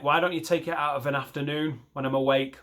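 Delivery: usual speaking voice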